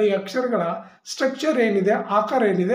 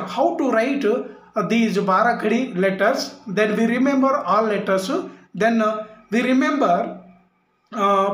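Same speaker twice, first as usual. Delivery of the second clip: slow explaining; much slower than usual. Shown as a demonstration.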